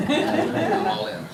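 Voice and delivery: slow words through a laugh. Real, not acted. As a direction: chuckle it out, real amusement. Guest jokes, laughing.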